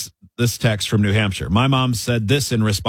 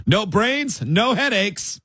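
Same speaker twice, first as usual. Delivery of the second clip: much higher-pitched mock mom voice; mom's singsong saying; 9 semitones higher, much slower than usual. Host imitating a mom addressing all her kids, high mock-mom voice.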